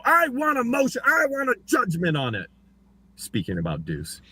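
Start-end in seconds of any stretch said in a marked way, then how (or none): 0.0-2.5 high, whiny mock demand for a motion; mock lawyer. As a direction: whine it like a petty lawyer. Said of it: "And they go".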